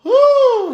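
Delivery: a shrill, loud whew of amazement; loud high whew. Real, not acted. Exhale after clip.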